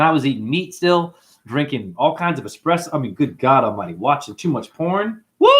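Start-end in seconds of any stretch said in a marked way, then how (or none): 5.4-5.5 sudden high whoop; short, high-pitched holler. Real, not acted. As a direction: let out a quick "woo". Whoops after confessing his excesses.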